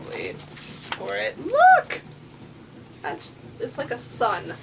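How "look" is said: high squeal of delight; excited exclaim. Real, not acted. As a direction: squeal it out, thrilled. Showing the pretty book.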